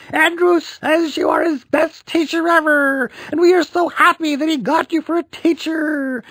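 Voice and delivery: very high, loud gushing voice; gushing mom. A narrator's reading. Mom meeting the teacher, very high gushing. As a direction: gush over the teacher.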